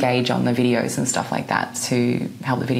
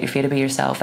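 usual speaking voice

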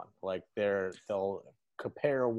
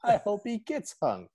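Emo Phillips voice; 8 semitones higher than usual. Retold or imitated. Lance gloating about the note, high comic whine. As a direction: whine it out, pleased with yourself. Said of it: "the emo Phillips voice".

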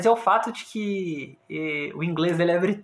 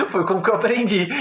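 usual speaking voice

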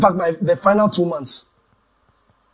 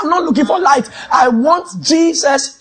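high, loud insistence, slowing at the end; retold shout; 7 semitones higher, 3 dB louder than usual. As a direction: shout that you want only Jesus. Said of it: "I said".

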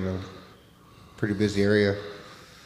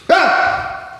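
scare scream; 8 dB louder than usual. Pretend. Jump scare on friend.

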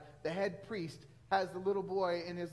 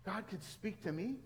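slow, hushed question rising at the end; acted awed disbelief; childlike wonder; 2 semitones higher than usual. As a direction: marvel as a little boy would. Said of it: "man, I was just like".

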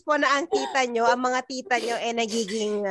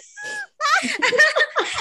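high laugh; 10 semitones higher than usual. Real, not acted. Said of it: "yung mga reaction mo talaga dun".

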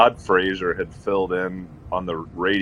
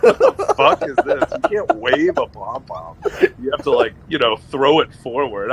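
higher, louder, laughing through fake fury; mock outrage; 4 semitones higher, 3 dB louder than usual. Fan complaining about pom-poms replacing towels, hammed-up outrage. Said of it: "I was like".

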